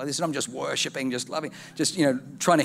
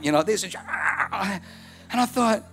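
usual speaking voice

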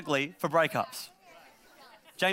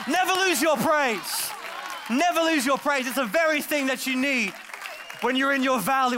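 raised, higher preaching voice; 7 semitones higher than usual. Acted. Preacher urging the church to praise, full force. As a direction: drive the point home to the crowd.

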